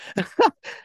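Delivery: short high-pitched chuckle; amused laugh. Real, not acted. Storyteller amused at not being believed, short laugh. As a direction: laugh briefly at your own story.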